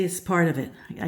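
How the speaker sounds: normal talking voice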